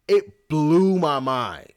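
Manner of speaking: "blew my mind" drawn out slowly, high and loud; emphatic amazement. Real, not acted. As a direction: stretch and punch "blew my mind". Punchline of the revelation.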